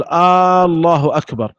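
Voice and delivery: الله said with a long drawn-out opening آ, like a question word; first hamza lengthened. Shown as a demonstration. Teacher showing a faulty adhan, lengthened hamza. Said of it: "كان يمد همزة الله".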